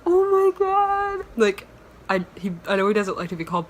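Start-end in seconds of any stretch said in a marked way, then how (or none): 0.0-1.2 very high, loud, drawn-out fan squeal; much higher and louder starstruck oh my god. Retold or imitated. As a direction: shriek like a fan meeting her idol. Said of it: "I'm going".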